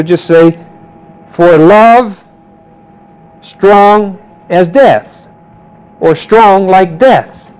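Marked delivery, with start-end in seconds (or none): 1.3-5.2 every word of "For love strong as death" slowed and weighted; emphatic, slow. Shown as a demonstration. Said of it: "really emphasize the fact". 6.1-7.5 "strong like death" drawn out and weighted; slow each word of "strong like death"; emphatic, slow. Shown as a demonstration. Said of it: "really emphasize the fact".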